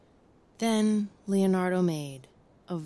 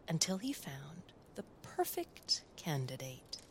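much softer whisper; 3 semitones lower, 11 dB quieter than usual. A narrator's reading. Narrator whispers finding the scaredy-cat kid, hushed. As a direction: lean in and whisper it.

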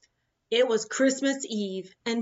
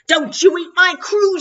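high, loud defiant shout; 8 semitones higher, 10 dB louder than usual. Acted. Captain shouting at the huge man to protect his crew, high and loud. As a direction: yell it up at the giant. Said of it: "shouted the captain".

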